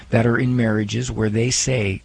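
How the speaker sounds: ordinary talk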